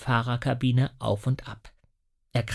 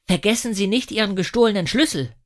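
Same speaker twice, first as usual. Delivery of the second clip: put-on higher, louder boy's voice; a boy eagerly chiming in; Lasse; 7 semitones higher, 5 dB louder than usual. A narrator's reading. (Junge, höher und lauter)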